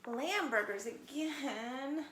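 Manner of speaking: slow, drawn-out whine; whining. Acted. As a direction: whine like a spoiled cub. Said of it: "he whined".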